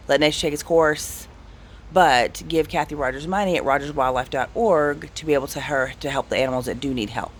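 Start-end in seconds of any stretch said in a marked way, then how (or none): none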